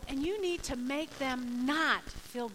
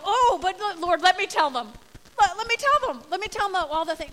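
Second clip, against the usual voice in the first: loud, high, eager begging; mock insistent pleading; 6 semitones higher, 9 dB louder than usual. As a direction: badger the Lord to let you speak. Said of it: "we would like to go".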